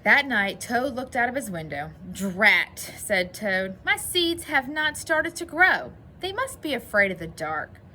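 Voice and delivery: lively storybook narration, bringing out the dialogue; story reading. A narrator's reading. Teacher reads Toad's troubles to boys and girls, animated story reading.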